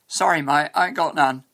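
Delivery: slangy English accent, "mate" as "mite"; colloquial British accent. Shown as a demonstration. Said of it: "kind of sentence you might hear anywhere".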